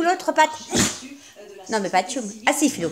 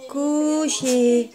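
ordinary delivery

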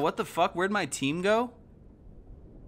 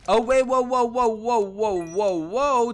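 loud, higher whoas of disbelief; amazed shout; 7 semitones higher, 8 dB louder than usual. Real, not acted. Gamer seeing two pangolins appear, shouting in amazement.

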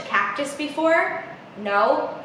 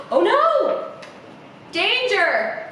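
high gasping cry of dismay; gasp, then wail it high; playful storybook panic; mock alarm; 8 semitones higher, slower than usual. A narrator's reading. Gasps at danger in story.

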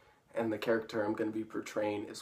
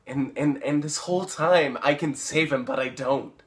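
louder, stammering insistence; worked up; 3 semitones higher, 8 dB louder than usual. Acted. Paul admitting he could save Chris, worked up. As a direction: push it out forcefully after a stumble. Acted, insisting he let him die.

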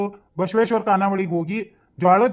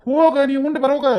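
high-pitched, huffy retort; irritated dismissal; 6 semitones higher, 3 dB louder than usual. Acted. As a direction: send her off impatiently. Husband irritably telling her to go. (ಸಿಟ್ಟಿನಿಂದ ಸಾಗಹಾಕುವುದು)

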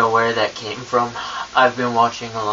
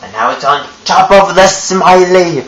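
put-on British accent; 8 semitones higher than usual. Ghost narrating his trick to viewers in a British accent. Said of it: "talking in a British accent this whole time".

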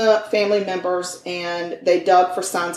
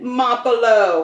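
Mapolo with an Italian accent; slow, lilting Italian Mapolo; 4 semitones higher, slower than usual. Shown as a demonstration. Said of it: "Say it in an Italian accent".

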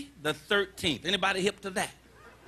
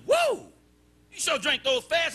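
amazed bartender; 6 semitones higher than usual. Acted. Bartender amazed at fast drinker.

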